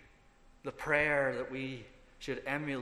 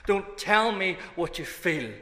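louder, higher preaching cry; preacher's cry; 6 semitones higher, 6 dB louder than usual. Retold or imitated. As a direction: call it out from the pulpit. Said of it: "he goes".

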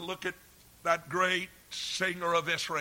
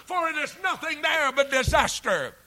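God's loving command; 8 semitones higher, 7 dB louder than usual. Acted. God calling Walter Burrell away from sin, pleading shout. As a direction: call him by name and warn him off sin. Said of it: "God's command is saying".

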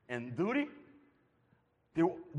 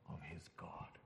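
whisper; 19 dB quieter than usual. Acted. Hushed climax on God's forsakenness.

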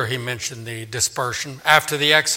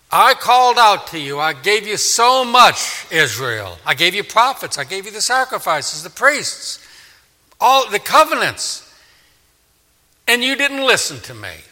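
loud, high lament of betrayal; impassioned divine rebuke; indignant reproach; 5 semitones higher, 6 dB louder than usual. Acted. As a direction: count off every gift, wounded and forceful. Preacher voices God reproaching Israel.

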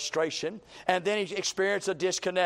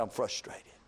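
dejected murmur; 5 semitones lower, 7 dB quieter than usual. Acted. Elijah answers dejectedly.

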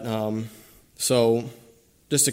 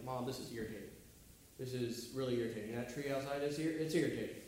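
quiet mock complaint; 14 dB quieter, faster than usual. Acting out a complaint to mom.

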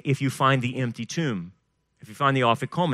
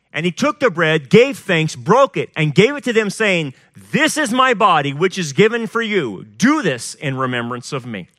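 loud, higher-pitched reading, dropping at the close; scripture proclamation; 4 semitones higher, 6 dB louder than usual. A narrator's reading. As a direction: proclaim the communion words with weight. Reads communion scripture aloud.

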